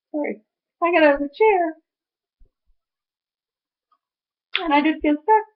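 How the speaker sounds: high voice choked with tears; real crying. Real, not acted. Apologising to viewers while retelling getting out of the chair, crying. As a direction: push the story out through tears. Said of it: "I started to cry, and I'm gonna do that with you guys".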